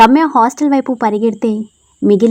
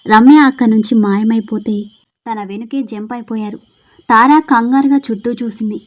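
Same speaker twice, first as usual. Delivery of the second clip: ordinary delivery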